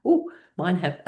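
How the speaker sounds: high, giggling "ooh"; pleased with herself; delighted laugh. Real, not acted. Delighted at exercise.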